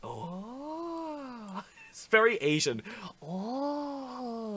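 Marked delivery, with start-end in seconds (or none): 0.0-1.6 long, high, soft "ohh"; imitated game-character coo. Player mimicking the game character, cooing high. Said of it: "that's how she sounds". 3.2-4.5 imitated game-character coo. Player mimicking the game character, cooing high. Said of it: "that's how she sounds".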